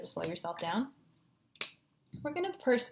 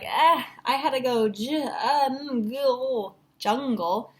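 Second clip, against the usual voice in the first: higher, louder mock reader drawing out each sound; 4 semitones higher, 5 dB louder, slower than usual. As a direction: imitate a student stretching J, UM, GUL, then blending JUNGLE. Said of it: "If you're somebody who's like".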